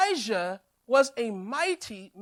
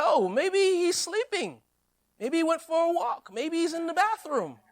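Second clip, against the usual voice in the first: taunting; 5 semitones higher than usual. Acted. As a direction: needle them with mock sympathy. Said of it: "He starts to taunt them".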